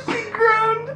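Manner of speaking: high, tearful laughing read; barely holding it together; giggly reading. Real, not acted. Reading erotic passage laughing.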